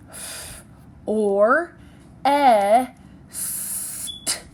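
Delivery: "f, or, e, st" one phoneme at a time; tapped out by sounds. Shown as a demonstration. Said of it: "let's tap that out".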